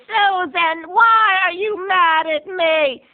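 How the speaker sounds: put-on high, slow, wounded old-lady voice; grandma whining to her daughter Susan, high and slow; whiny grandma. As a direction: play the hurt innocent grandmother. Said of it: "And Grandma says".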